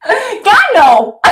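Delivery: high-pitched mock anguish; hammed-up wail; fake grief cry. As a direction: burst out in staged despair. Rehearsed burst of fake grief.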